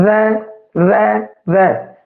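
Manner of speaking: ਰੈ drawn out slowly, one at a time; slow sound demo. Shown as a demonstration. Teacher slowing down so students see the tongue. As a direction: stretch each ਰੈ very slowly. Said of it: "ਜੀਭ ਤੁਹਾਨੂੰ ਸਪਸ਼ਟ photo ਵਿੱਚ ਦਿਖ ਰਹੀ".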